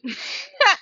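short high burst of laughter; real laugh at her own thought process. Real, not acted. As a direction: laugh at yourself, high and sudden.

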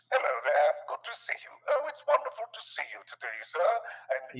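high butler voice, light and quick; John Cleese. Shown as a demonstration. Butler character Jarvis greeting sir, high John Cleese impression. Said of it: "John Cleese".

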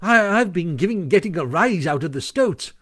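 lightly gleeful boast; pleased with himself. A narrator's reading. Mole boasts of teasing the stoats, pleased. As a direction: share the prank with relish. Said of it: "evidently very pleased with himself".

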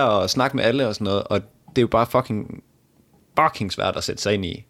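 ordinary talk

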